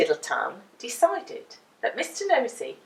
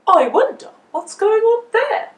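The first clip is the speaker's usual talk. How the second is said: high, louder curious voice; Mister Nosey; 11 semitones higher, 8 dB louder than usual. A narrator's reading. Mister Nosey wondering at the laundry wall, high curious voice. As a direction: wonder aloud, pitched up. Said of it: "he thought to himself".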